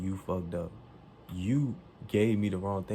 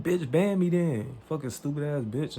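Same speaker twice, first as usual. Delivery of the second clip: loud, higher-pitched angry outburst; going off; 7 semitones higher, 5 dB louder than usual. Retold or imitated. Customer daring clerk to ban them, going off. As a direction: dare them, raised voice, drawn out at first. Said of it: "Now I'm going off".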